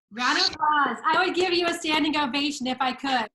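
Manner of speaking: enthusiastic cheering. Real, not acted. Host applauds guest after the introduction, loud cheering.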